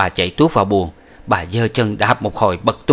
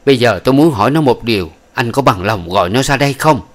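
louder, fast, sharp questioning; harsh demand; 2 semitones higher, 4 dB louder than usual. Acted. Old woman harshly demanding her grandson's husband fetch the wife, fast and loud. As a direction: press him hard and fast. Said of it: "bà hỏi một cách gắt gao".

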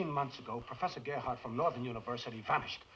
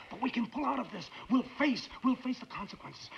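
high-pitched, desperate persuasion; insistent plea, voice pitched high; urgent pleading; 8 semitones higher than usual. Acted. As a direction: beg your partner to get out while there's time. Pleads with Sam to quit.

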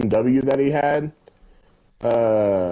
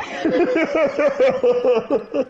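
real amused cackle; laughing at the absurdity; high burst of laughter; 11 semitones higher than usual. Real, not acted. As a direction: break into a laugh. Laughs at the story.

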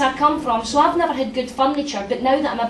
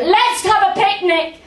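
a bright, higher mock shout; 7 semitones higher, 3 dB louder than usual. Mock shout of a cheery man who would never be Gordon Brown. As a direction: yell it like a jolly picnic host. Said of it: "the kind of man that would shout".

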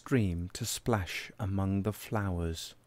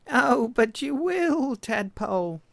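lamb voice; 5 dB louder than usual. Acted. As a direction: stretch it out high as the lamb. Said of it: "said Lamb".

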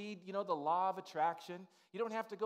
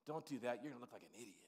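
low, soft, scolding; the second voice in his head, quiet and lower; doubting inner voice; 7 semitones lower, 9 dB quieter, faster than usual. Retold or imitated. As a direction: hush him down with a warning. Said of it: "another voice says".

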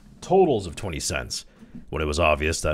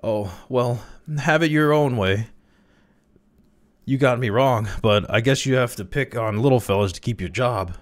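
high-pitched, louder protesting surrender; quailing; 7 semitones higher, 4 dB louder than usual. A narrator's reading. Crawley gives in to the spotter's accusing eye, quailing. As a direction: give in with a wounded, higher voice. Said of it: "he quailed before the spotter's accusing eye".

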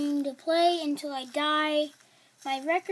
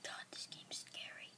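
scared hushed whisper; 18 dB quieter than usual. Real, not acted. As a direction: breathe it out under your breath. Alone playing a horror game.

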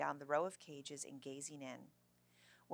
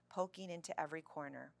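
ordinary delivery

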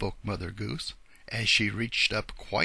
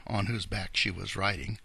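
ordinary delivery

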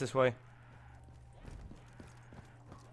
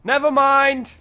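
high, loud exclamation; alarmed shout; 10 semitones higher, 11 dB louder, slower than usual. Real, not acted. Player realising the weapon is wrong, alarmed shout. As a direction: blurt it out loudly, abandoning the idea. Corrects self amid game action.

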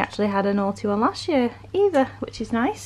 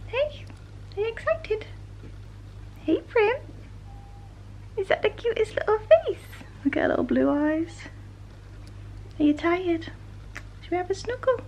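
puppy talk; 10 semitones higher than usual. Real, not acted. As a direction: coo at the pups like tiny babies. Talking to the puppies.

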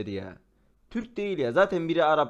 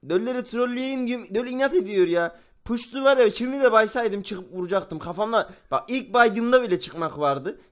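raised, heated rant; nerves frayed, annoyed; 5 semitones higher, 4 dB louder than usual. Real, not acted. Gamer ranting that he should have shot Mahmut, worked-up annoyance. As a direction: complain loudly in a high, worked-up voice. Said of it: "çok sinirlerim bozuldu".

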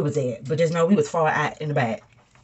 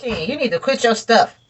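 sharp scold; 8 semitones higher, 9 dB louder than usual. Real, not acted. Mother scolds son.

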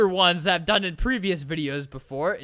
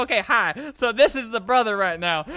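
ordinary delivery

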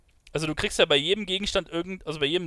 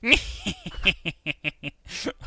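witch cackle; 2 semitones higher than usual. Pretend. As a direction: cackle like a fairy-tale witch. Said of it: "Das war mein bestes Hexenlachen".

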